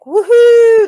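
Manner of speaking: high, loud party whoop; excited cheer. Retold or imitated. Vlogger imitating partygoers on the recording, a loud excited whoop. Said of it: "They're all excited about something".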